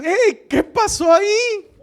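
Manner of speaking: high, slow, loud cry of surprise; startled outcry. Retold or imitated. Startled believer crying out at the sudden turn. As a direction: cry out in shock, drawn out. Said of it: "Tú dices".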